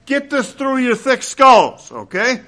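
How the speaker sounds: high, loud scolding shout; Paul scolding. Retold or imitated. Paul shaking the Ephesians by the collar, loud exasperated shout. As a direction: grab them by the collar with your voice. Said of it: "He's figuratively grabbing the Ephesians by the collar and saying".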